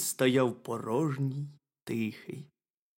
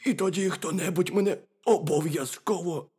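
high, raised hare voice; fearful hare; 6 semitones higher, 4 dB louder than usual. A narrator's reading. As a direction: plead it as a small worried hare. Hare fears being eaten.

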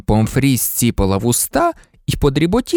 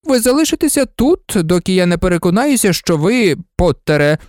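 high, insistent scolding voice; a stern telling-off in a raised pitch; stern character; 7 semitones higher than usual. Acted. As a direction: voice someone laying down orders.

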